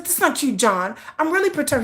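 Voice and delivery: high-pitched mock indignation; retold fussing. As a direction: protest to a husband who won't take it seriously. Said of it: "I would fuss".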